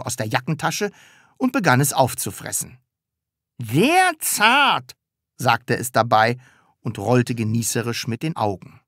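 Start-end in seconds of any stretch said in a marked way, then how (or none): 3.6-4.9 high, relishing munch; savouring mouthful. A narrator's reading. Sams tasting a handkerchief, relishing with mouth full. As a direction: savour it while eating.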